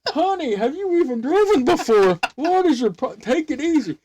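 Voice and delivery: high, loud mock exasperated teacher. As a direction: play the frazzled instructor high. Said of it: "He goes like".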